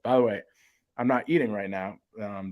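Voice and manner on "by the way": ordinary delivery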